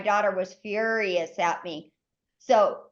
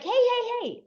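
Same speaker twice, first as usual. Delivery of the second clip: high, urgent calls to stop; a high alarmed warning at a man with a gun; alarmed hey; 12 semitones higher than usual. Retold or imitated. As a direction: stop him fast, pitched high. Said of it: "And I was like".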